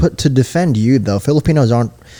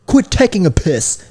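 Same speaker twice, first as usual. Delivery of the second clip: mock British, a bit higher; British slang; 7 semitones higher than usual. Shown as a demonstration. Showing a friend what British people say, mock British accent. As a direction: toss off a cheeky British line. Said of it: "what's something a British person would say".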